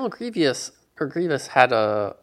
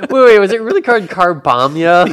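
surprised; 7 semitones higher, 12 dB louder than usual. Real, not acted. Incredulous at the name.

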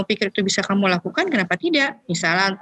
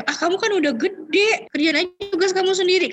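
dismissive parent; 11 semitones higher than usual. Acted. Dismissive parent brushing off child.

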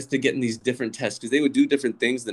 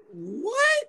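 high-pitched disbelieving what; shocked reaction. Retold or imitated. Student reading the teacher's comment, shocked. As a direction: squeak it out in mock shock. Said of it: "And I'm like".

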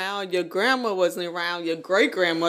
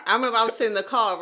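ordinary talk, nothing marked

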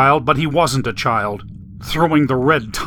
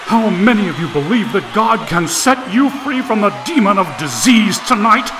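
louder, higher revival shout; booming preacher; 8 semitones higher, 4 dB louder than usual. A narrator's reading. Preacher calling ten thousand to say amen, booming. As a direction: drive the congregation to a peak. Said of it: "a man's powerful voice boomed out".

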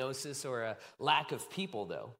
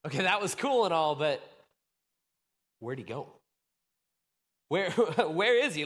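mock-baffled, half laughing; puzzled disciple; 5 dB louder than usual. Disciples staring at the sky, mildly puzzled. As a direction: ask like someone who lost their friend. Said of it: "some of those disciples were like".

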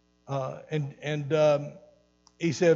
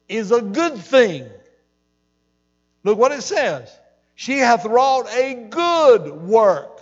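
fired-up preaching; 4 semitones higher, 7 dB louder than usual. Real, not acted. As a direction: preach Jesus's words with full force. Preaching at full force.

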